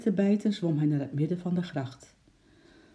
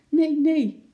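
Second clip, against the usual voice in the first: high, loud cry of alarm; calling out; 12 semitones higher, 8 dB louder than usual. A narrator's reading. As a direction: shout a warning to the policeman. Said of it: "riep de meneer".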